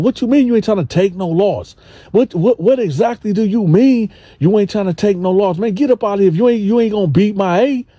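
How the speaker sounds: high, challenging mock threat; Gene voiced aggressive. Gene challenging the dude over the loss, high and aggressive. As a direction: play Gene squaring up, pitched high. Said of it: "He was like".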